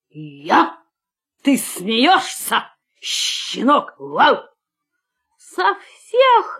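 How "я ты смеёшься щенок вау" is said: low, slow, menacing dog voice; big growling dog. A narrator's reading. Huge dog scoffs at the puppy, low and menacing. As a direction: answer deep and slow, ending in a bark.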